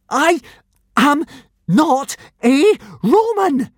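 slow, loud, high gasped denial; gasping. A narrator's reading. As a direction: gasp it out word by word with pauses. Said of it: "gasped Hiccup".

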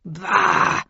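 loud, rough exclamation of disgust; explosive scoff; angry cry. A narrator's reading. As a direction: burst out in outrage. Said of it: "cried Edgar".

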